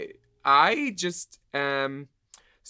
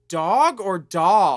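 retold challenge; 4 semitones higher, 7 dB louder, much slower than usual. Retold confrontation, words drawn out.

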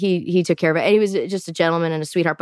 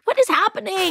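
high-pitched, incredulous question; mock-baffled high outburst; retold bewilderment; 11 semitones higher than usual. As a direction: replay your disbelief, pitch shooting up. Replaying bewilderment on the bad date.

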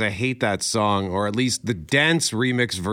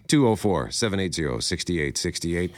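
normal talking voice

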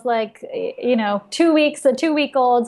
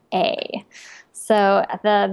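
ordinary delivery

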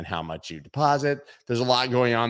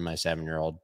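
ordinary talk, nothing marked

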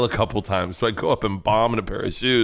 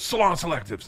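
mock shout; 8 semitones higher, 3 dB quieter than usual. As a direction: imitate the brain blurting nonsense. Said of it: "My brain goes".

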